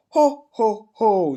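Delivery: loud, high jolly ho ho ho; a jolly Santa laugh, slipped in by mistake. Acted. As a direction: boom out a Santa chuckle. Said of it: "that's Santa".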